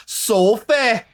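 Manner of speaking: high, loud British "Sophie", drawn-out soh-fay. Retold or imitated. Imitating how the British cast say "Sophie". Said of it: "the tough one for you is the".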